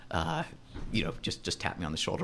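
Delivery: low hushed aside; conspiratorial murmur. Pretend. Secret invitation, hushed.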